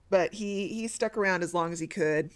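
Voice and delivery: usual speaking voice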